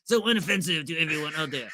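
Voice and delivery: hammed-up French accent; bad French accent. Host parodying a fussy word corrector, bad French accent. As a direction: lay on a terrible mock French accent. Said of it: "That's a terrible, and I apologize to any".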